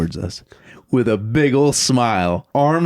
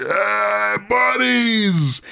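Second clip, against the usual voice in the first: Mike greeting; 12 semitones higher, much slower than usual. Retold or imitated. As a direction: call out like an overeager drunk. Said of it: "He's like".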